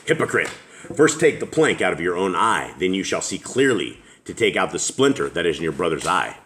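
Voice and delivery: ordinary talk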